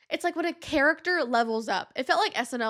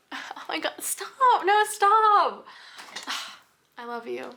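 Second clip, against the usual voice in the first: dying movie wife; 3 semitones higher than usual. Pretend. The dead wife in the movies says goodbye, hammed-up and tearful. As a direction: ham up a tragic movie death scene. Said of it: "my impression of the dead wife in the movies".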